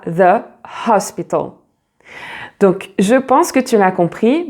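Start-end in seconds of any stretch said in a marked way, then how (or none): 0.0-1.5 "the" as thuh, "hospital" with an aspirated h; English "the hospital". Shown as a demonstration. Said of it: "Et alors là, ça sera".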